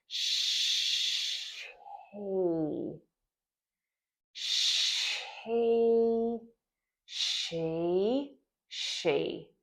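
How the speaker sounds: "sh" held long and unvoiced, then "he" joined on, sped up to "she"; h insertion demo. Shown as a demonstration. Speech therapist modeling "she" for a child, slow to fast. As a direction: stretch the sh into he, then blend into "she". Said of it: "the correct way to teach the H insertion trick".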